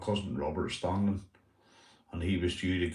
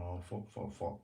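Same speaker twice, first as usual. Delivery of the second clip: muttered dread; 2 semitones lower, 14 dB quieter than usual. Retold or imitated. Waiting for the ruler, muttering.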